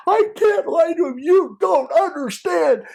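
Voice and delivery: very high, loud, slow wailing plea; imitating wailing sob. Undercover sobbing to the trafficker, very high loud wail. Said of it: "And he is just crying".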